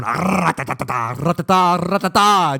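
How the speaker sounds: rattling mock-bear noises; rapid playful growly syllables; imitated bear snarls. Two boys going at each other like bears, mock snarling. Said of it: "we're like bears just going".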